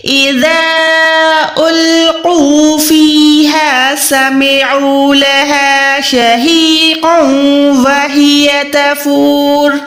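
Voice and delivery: slow melodic tajweed recitation of the verses; Quran recitation. Shown as a demonstration. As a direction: chant the whole verse slowly, drawn out. (धीमी तिलावत)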